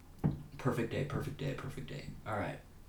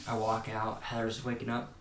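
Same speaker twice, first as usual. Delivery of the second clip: ordinary delivery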